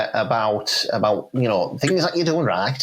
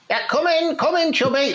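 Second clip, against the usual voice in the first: higher, sing-song call toward the door; 14 semitones higher than usual. Acted. Manager calls player into the office, bright sing-song call. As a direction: call him in cheerfully from across the room.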